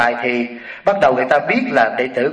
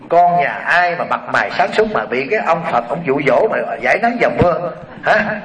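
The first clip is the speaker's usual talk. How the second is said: usual speaking voice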